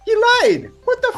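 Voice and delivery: squeaky high disbelief; shocked outburst. Real, not acted. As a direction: shriek it in mock outrage. GM shocked at player.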